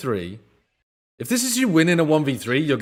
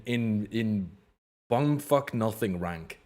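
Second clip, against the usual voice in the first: ordinary talk, nothing marked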